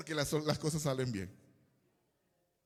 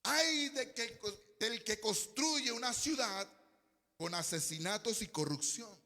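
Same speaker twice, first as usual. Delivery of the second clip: exclaimed scripture reading; 2 semitones higher, 3 dB louder, slower than usual. Real, not acted. Preacher reads verse twelve to the church, drawn-out warning. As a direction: cry the "Ay", then read on weightily.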